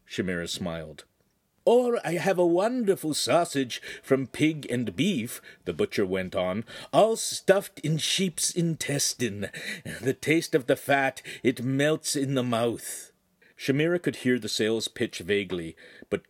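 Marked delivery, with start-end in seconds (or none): 1.6-5.4 butcher's sales voice. Acted. Butcher voice again. 6.9-13.1 higher, slow, coaxing shopkeeper; butcher's sales voice. Acted. Butcher coaxing the signora to buy, higher and slow.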